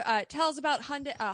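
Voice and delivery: normal talking voice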